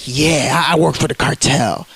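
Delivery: low mock cop admission; imagined cop. Voicing what cops want to say.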